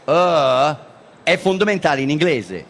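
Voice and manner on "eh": long held "uh" vowel; dying-man groan. Shown as a demonstration. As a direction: demonstrate the vowel as a drawn-out groan. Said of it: "è veramente un suono importante". (lungo gemito dimostrativo)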